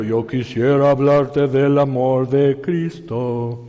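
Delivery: mock churchy invitation. Mocking a stiff churchy invitation.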